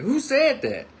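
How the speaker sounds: now really mean it, push harder; angrier demand. Retold or imitated. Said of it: "And I meant it".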